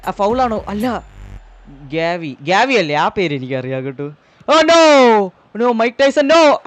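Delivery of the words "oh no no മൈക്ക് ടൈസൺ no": high, loud oh no; dismayed cry. Real, not acted. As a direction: wail as the chance goes. Dismay at chance.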